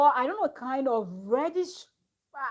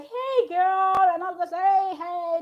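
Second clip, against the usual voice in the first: put-on high, loud girly hello; excited greeting; 8 semitones higher, 5 dB louder, slower than usual. [name] and all of us greeting Laura, excited. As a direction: call out a bright hello to a friend.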